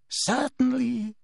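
a hint of a slow, drawn-out sneer; lip-curling answer; slow sneering Snape. A narrator's reading. Snape to Umbridge, a slow sneer.

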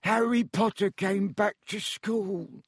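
high, slow, woeful elf voice; plaintive creature, drawn-out misery; miserable house-elf. A narrator's reading. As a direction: lament it in a small high voice. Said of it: "he whispered miserably".

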